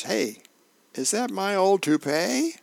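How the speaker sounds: a husband startled to spot his toupee; surprised Earl. Acted. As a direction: jump up in pitch, caught off guard. Earl spots cat with toupee.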